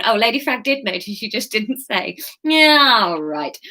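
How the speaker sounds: ordinary talk, nothing marked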